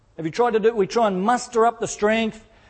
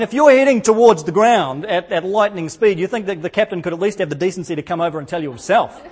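ordinary delivery

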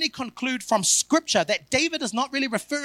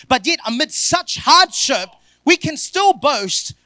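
loud, higher, slow proclamation; triumphant preaching; 6 semitones higher, 6 dB louder than usual. Acted. Preacher to congregation, a slow triumphant proclamation. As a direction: boast it out, slow and big.

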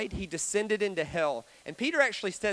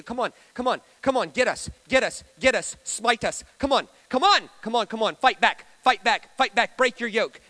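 loud, repeated urging in short bursts; goading tormentors; 3 semitones higher, 6 dB louder than usual. Acted. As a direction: egg him on to fight. Acted tormentors goad Jesus to fight.

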